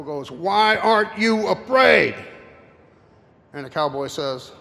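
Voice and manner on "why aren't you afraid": high, loud, slow devil roar; exasperated Satan. Acted. Satan roars at the fearless cowboy, slow, high and loud. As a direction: drag it out in disbelief, much louder.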